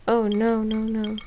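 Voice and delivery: ordinary delivery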